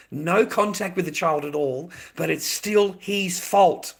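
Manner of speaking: indignant outburst. Real, not acted. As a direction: lean hard on "fault", loud and slow, fed up. Ranting about fathers blamed unfairly.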